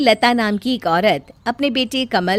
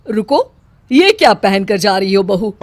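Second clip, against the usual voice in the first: louder, sharp rebuke; stern mother-in-law scolding; 7 dB louder than usual. Acted. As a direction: halt her at the door, disapproving. Mother-in-law stops daughter-in-law.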